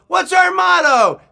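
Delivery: high, loud, drawn-out call; imitating a scoutmaster's big shout; leader yelling. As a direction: yell the question to rally the troop. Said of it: "he yelled".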